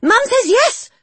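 put-on very high, loud gleeful kid's voice; excited child. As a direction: crow the news to the big brother. Boy reporting excitedly to brother.

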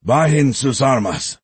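loud, high commanding shout; gruff shout. Acted. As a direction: roar the order at the soldiers. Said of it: "shouted a gruff voice".

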